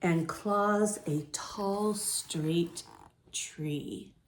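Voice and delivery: slow, gentle read-aloud; story narration. A narrator's reading. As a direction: read it calmly to young listeners. Reads picture book to children.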